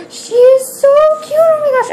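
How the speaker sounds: high, loud squeal over something cute; high-pitched squeal of delight; excited squeal. Real, not acted.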